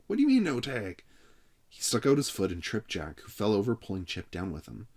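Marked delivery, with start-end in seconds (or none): 0.1-1.0 high, loud indignant challenge. A narrator's reading. Frank challenging the referee, high and indignant. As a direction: demand it indignantly. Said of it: "cried Frank".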